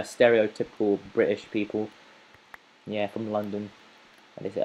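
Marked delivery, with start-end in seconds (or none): none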